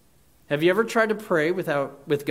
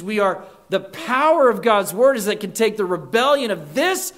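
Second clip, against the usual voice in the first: loud, raised sermon build; rising preacher's fervor; full-force preaching; 5 semitones higher, 6 dB louder than usual. Real, not acted. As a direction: press the point home to the congregation.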